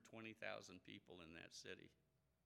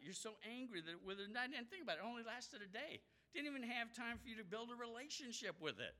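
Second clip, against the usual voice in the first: much higher, impassioned preaching; preaching at full force; 13 semitones higher than usual. Acted. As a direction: drive it home to the congregation. Preacher presses the point.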